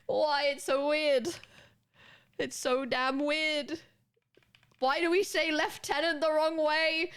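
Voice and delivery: high-pitched, incredulous mock rant; mock exasperation. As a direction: pitch it up in baffled complaint. Mock rant about odd pronunciations.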